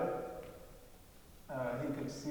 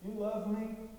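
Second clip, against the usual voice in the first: quoting him; 7 semitones higher, slower than usual. Retold or imitated. Quoting his question again.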